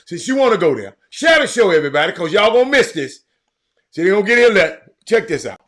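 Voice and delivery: loud and high, all fired up; rally the audience at full volume; hyped shout. Real, not acted. Host shouting to viewers to share the show, hyped and loud.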